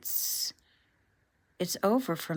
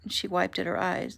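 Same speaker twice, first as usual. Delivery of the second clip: ordinary delivery, nothing marked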